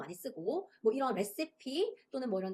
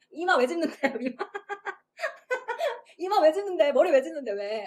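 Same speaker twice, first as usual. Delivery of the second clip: laughing; 5 semitones higher, 8 dB louder than usual. Real, not acted. Teacher laughs at students' gesture.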